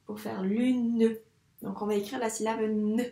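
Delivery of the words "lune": "lune" slowed into lu-nne; drawn-out "lune", long n; syllable by syllable. Shown as a demonstration. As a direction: stretch "lune" so the final ne shows. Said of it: "il nous manque le ne".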